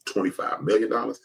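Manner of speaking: normal talking voice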